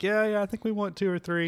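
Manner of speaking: lightly voiced excited friend; high mock friend voice. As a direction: imitate the friend's eager reply.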